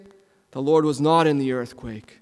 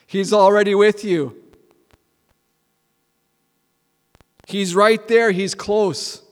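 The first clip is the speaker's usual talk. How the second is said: higher and louder, urgent; fervent assurance; 4 semitones higher, 4 dB louder than usual. Real, not acted. Preacher assuring the church God is already there, fervent. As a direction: press it home with joy.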